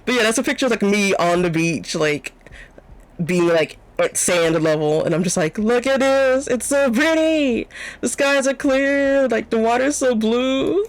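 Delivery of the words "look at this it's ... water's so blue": high, drawn-out gushing over the view; gushing delight. Retold or imitated. Showing off the beach in the picture, gushing. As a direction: coo in delight, stretching the words out.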